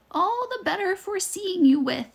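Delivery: wolf faking a frail grandma; wobbly fake grandma. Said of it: "in the same wobbly voice".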